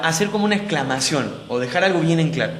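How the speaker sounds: usual speaking voice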